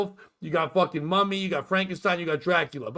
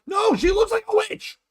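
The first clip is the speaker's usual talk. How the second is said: high, loud exasperated yell; frustrated outburst at a friend; exasperated shout; 14 semitones higher, 3 dB louder than usual. Real, not acted. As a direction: shout her down in disbelief. Exasperated reply in the argument.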